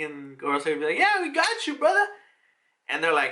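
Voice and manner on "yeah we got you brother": very high, jokey put-on voice; mock chummy reply. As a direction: agree like a buddy, pitched way up. Voicing the Gorosei's imagined easy reply.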